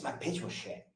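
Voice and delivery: a hushed self-deprecating whisper; whispered aside. Retold or imitated. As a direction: whisper it like a private thought. Mock inner thought of caller.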